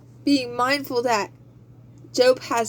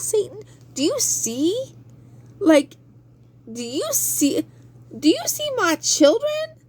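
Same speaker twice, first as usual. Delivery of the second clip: high, pressing challenge; God challenging Satan; 8 semitones higher than usual. Acted. God taunts Satan about his children, high. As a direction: push the question at Satan, climbing high.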